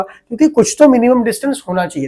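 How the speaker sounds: usual speaking voice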